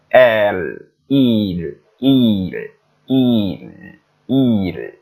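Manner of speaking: il with a clear French i and light l. Shown as a demonstration. Teacher drilling il for the class. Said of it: "I do not say il".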